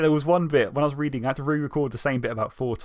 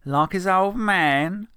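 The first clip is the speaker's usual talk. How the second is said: slow, higher mocking scoff; 6 semitones higher, 5 dB louder, much slower than usual. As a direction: sneer at it slowly. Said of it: "scoffed Mick".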